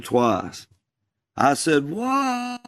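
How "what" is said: high hollered answer; hollering back. Retold or imitated. Boy calling back across the pasture, high holler. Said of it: "I hollered across the pasture back".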